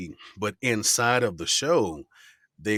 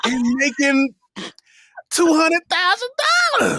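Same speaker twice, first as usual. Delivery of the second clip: excited high exclamation; 9 dB louder than usual. Real, not acted.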